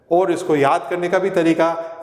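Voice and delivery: ordinary talk, nothing marked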